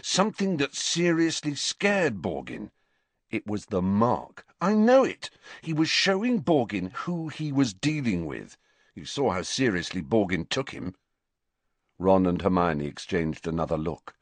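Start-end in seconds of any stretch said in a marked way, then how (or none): 0.0-10.9 higher, urgent arguing that peaks midway; dogged, heated persuasion; stubbornly insistent. A narrator's reading. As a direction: keep pushing the case, refusing to drop it. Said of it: "Harry pressed on stubbornly".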